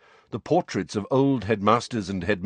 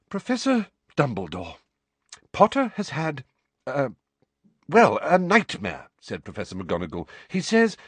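flustered McGonagall; 5 semitones higher than usual. Acted. McGonagall reports nightmare.

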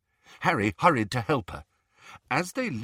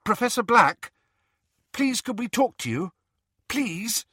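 high-pitched polite pleading; 12 semitones higher, 3 dB louder than usual. A narrator's reading. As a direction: coax an answer, voice pitched high. Pleading with the silent portrait.

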